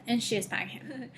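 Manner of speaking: plain reading voice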